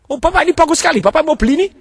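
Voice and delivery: very high-pitched exaggerated praise; put-on high, fawning voice; over-the-top flattering act. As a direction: gush way up high, hammed-up. Mock customer in seated demo.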